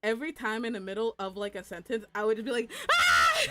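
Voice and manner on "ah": loud, high scream; sudden piercing acted shriek; re-enacted scream. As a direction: scream out mid-sentence. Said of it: "I would scream".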